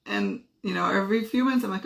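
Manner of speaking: ordinary talk, nothing marked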